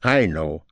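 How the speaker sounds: emphasize "I" over "know"; emphatic I. Shown as a demonstration. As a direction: stress on "I" in "I know". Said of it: "raise your voice to emphasize I".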